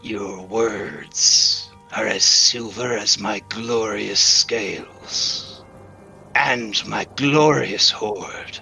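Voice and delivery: a hint of a smug old dragon rumbling. GM voices dragon again.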